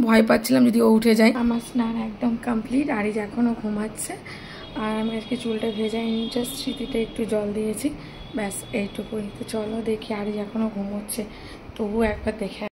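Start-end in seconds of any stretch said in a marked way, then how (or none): 1.4-11.2 hushed. Real, not acted. Mother updating viewers beside sleeping baby, hushed. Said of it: "আমাকে আস্তে আস্তে কথা বলতে হচ্ছে". 11.8-12.6 hushed, fading into a whisper; speaking very softly. Real, not acted. Mother checking on sleeping baby, whispering to viewers. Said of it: "আমাকে আস্তে আস্তে কথা বলতে হচ্ছে".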